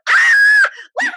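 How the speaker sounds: high, loud shriek of delight; a loud, high gleeful scream; excited shriek. Retold or imitated. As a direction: squeal it out in triumph. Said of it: "I'm going".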